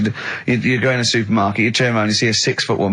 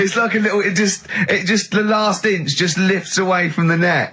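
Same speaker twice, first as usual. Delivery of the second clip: amused; 7 semitones higher than usual. Real, not acted. Describing wig, still laughing.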